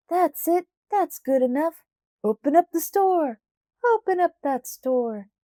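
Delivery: high, loud mob chant; a mob egging each other on; crowd yelling. A narrator's reading. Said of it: "Then the whole crowd yelled".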